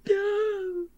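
high, slow mock-sobbing no; hammed-up grief wail. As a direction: wail it like a heartbroken fan, hammed up. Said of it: "And so I was like".

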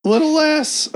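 high nagging voice; mock picky old lady. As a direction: nag like a fussy customer. Picky customer retold.

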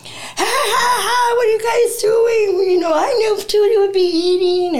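high, loud cheerful cousin voice; excited cousin greeting. Retold or imitated. Cousin greeting newcomers at the door, high and loud. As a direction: call out like an overjoyed cousin.

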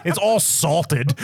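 normal talking voice

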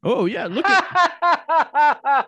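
slow words through laughter; laughing. Real, not acted. Laughs while talking.

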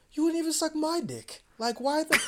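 high-pitched incredulous outburst; retold explosion. Retelling how he confronted his girlfriend, exploding. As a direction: explode in indignant, squeaky-high protest. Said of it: "I just exploded".

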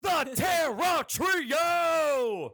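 very slow, high, shouted showman finale; ringmaster. Pretend. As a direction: drag it out like a circus barker. Grand finale of intro.

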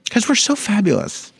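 campy. Pretend. Campy self-praise.

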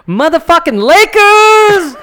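loud slow stadium yell; shout. Retold or imitated. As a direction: bellow it like a fan. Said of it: "I said".

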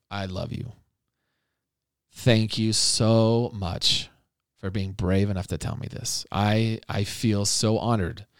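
acted loving parent, hushed and sincere; tender reassurance. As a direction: reassure a child gently, taking your time. Models parent's reassuring reply to child.

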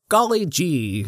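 put-on high-pitched, naive farm-boy exclamation; goofy small-town dork. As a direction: gush like a wholesome country dork. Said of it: "goofy dork from a small town who still talks like".

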